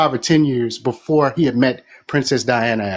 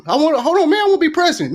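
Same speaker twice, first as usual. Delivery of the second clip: high pleading; 11 semitones higher, much faster than usual. Retold or imitated. The newcomer begging Nigerians for a job, high pleading. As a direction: plead like an eager outsider. Acted exchange continues.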